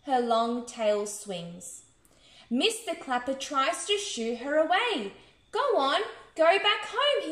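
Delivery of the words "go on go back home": higher, moderately raised narrated shout; mild shout. A narrator's reading. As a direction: shoo it away in a storybook shout. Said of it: "he shouts".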